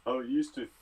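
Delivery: normal talking voice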